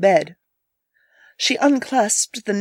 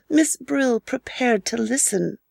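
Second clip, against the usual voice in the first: usual speaking voice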